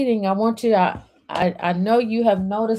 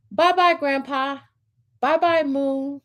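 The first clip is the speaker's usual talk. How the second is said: put-on child waving goodbye; 7 semitones higher than usual. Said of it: "Max waves goodbye".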